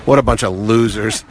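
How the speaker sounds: low scornful aside to camera; Adam West. Retold or imitated. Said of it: "he looks right into the camera and says".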